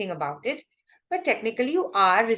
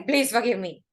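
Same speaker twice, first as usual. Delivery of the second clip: defiant, not sorry. Shown as a demonstration. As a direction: say "Please forgive me" like you don't mean it. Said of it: "You can't say".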